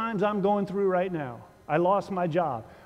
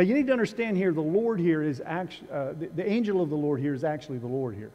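ordinary delivery

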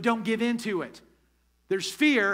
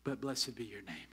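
much lower and softer, a settled surrender; hushed praise; 11 semitones lower, 12 dB quieter than usual. Retold or imitated. Pastor voicing David after his outburst, hushed reverent praise. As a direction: drop right down after the storm, quietly. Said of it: "here's what David said".

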